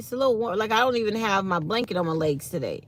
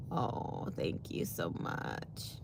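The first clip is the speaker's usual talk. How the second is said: low slow whisper; 13 semitones lower, 12 dB quieter, much slower than usual. Real, not acted. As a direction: breathe the thanks softly. Mock humble whisper thanks.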